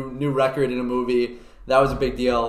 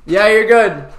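raised, higher calling voice; calling back; 7 semitones higher, 8 dB louder, slower than usual. Real, not acted. Host answering Sam off the balcony, loud call. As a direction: call it loudly to someone far off. Calls back to distant person.

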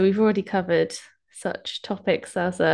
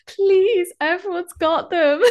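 pleading; 10 semitones higher, 4 dB louder, slower than usual. Retold or imitated. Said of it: "And I was like".

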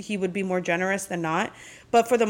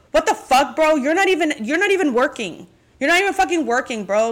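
loud, high, exasperated yelling at him; furious outburst at a partner who does nothing; angry rant; 6 semitones higher, 5 dB louder than usual. Retold or imitated. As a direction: let rip at a lazy boyfriend. Venting imagined reply at boyfriend.

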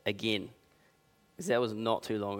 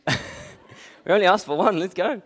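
excited; 8 semitones higher, 8 dB louder than usual. Retold or imitated. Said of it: "I actually said that to the boys".

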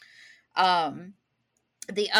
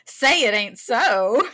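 mock Southern lady gasp; 6 semitones higher, 8 dB louder than usual. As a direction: play a scandalized sweet Southern lady. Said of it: "And I was like".

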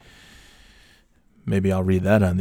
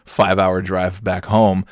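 ordinary talk

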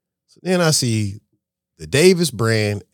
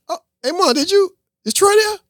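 high bright call; 5 dB louder than usual. Retold or imitated. Greeting his mom on FaceTime, high and eager. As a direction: voice jumps high to catch her.

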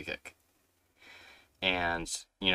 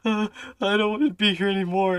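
high, louder mock sobbing whine; mock crying kid; 12 semitones higher, 5 dB louder than usual. Kicked kid on the bench, mock sobbing whine. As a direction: whimper it like a kid who gave up. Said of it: "I'm like".

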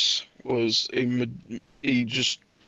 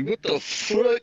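slow, higher mock outrage; mock disbelief; 7 semitones higher, slower than usual. Friend mocks ordering chicken with gravy, slow high disbelief. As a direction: drag it out, playing shocked.

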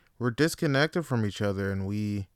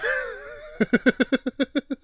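high laugh; 12 semitones higher than usual. Real, not acted. Laughing at own bad accent.

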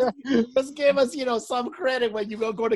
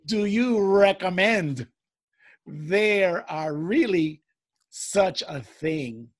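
slow letter reading; 4 semitones lower, much slower than usual. Acted.

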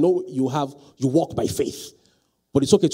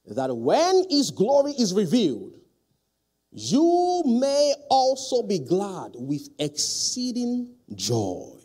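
louder, raised formal reading voice; scripture reading; 4 semitones higher, 4 dB louder than usual. Acted. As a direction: read the verse as solemn proclamation. Reads scripture aloud.

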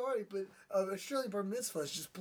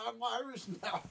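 ordinary talk

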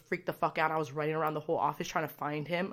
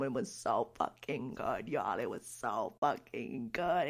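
low, slow, much softer, savoring every word; hushed awe; 7 semitones lower, 7 dB quieter, slower than usual. Real, not acted. Telling viewers how good the set was, hushed slow awe. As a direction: drop to a low hush, slow, almost reverent. Awed by the live performance.

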